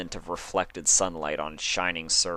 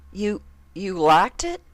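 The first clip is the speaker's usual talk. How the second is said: higher, louder stammering; stunned disbelief; 13 semitones higher, 7 dB louder than usual. Acted. Applejack asks Dash in disbelief, stammering. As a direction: stammer in shock.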